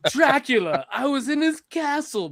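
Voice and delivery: a hint of bad British-accent acting; lightly mock a stilted, wooden film delivery; Keanu Reeves. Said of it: "than Keanu Reeves' fucking British accent".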